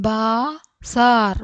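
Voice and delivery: slow, stretched, split in two; syllable by syllable. Shown as a demonstration. Teacher sounding out a word for learners, syllable by syllable. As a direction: hold each syllable apart.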